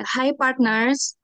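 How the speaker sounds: ordinary delivery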